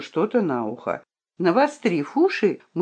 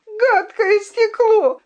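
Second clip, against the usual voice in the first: high, slow, furious cry; angry scream; 3 dB louder, slower than usual. A narrator's reading. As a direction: hurl the words at the glass. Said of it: "закричала королевна".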